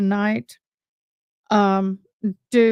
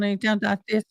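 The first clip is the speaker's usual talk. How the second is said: imitating toothless mumble; 3 dB quieter, faster than usual. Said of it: "talking about it like this".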